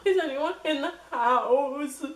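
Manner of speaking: high, tearful asking; high tearful whimper; crying question. Retold or imitated. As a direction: ask through tears. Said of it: "I'm, like, crying".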